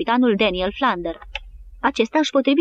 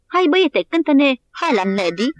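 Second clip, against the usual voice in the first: much higher, louder cheerful call; shouting over the water; 6 semitones higher, 4 dB louder than usual. A narrator's reading. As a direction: call out to the piper across the surf. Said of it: "Lovett îi strigă". (strigăt vesel către cimpoier)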